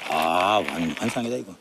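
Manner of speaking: talking through hot food in mouth; mouth full of hot food. Real, not acted. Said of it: "아, 뜨거워". (뜨거운 음식을 입에 물고)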